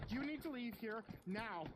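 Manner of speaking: firm mother. Acted. Mother orders stranger away.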